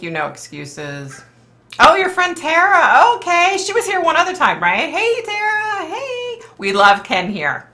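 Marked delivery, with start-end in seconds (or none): 1.8-6.4 very high, delighted singsong hello; loud, high-pitched, gushing recognition; excited greeting. Real, not acted. Greets a viewer's friend in chat.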